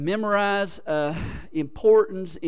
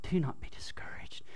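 hushed reading; 12 dB quieter than usual. Acted. Reading Joshua's charge aloud.

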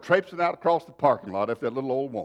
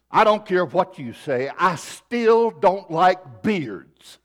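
mock in-your-face old lady, louder; old lady with attitude; 4 semitones higher, 5 dB louder, much slower than usual. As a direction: dig in stubbornly as an old lady, pitch raised. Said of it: "kinda had an attitude".

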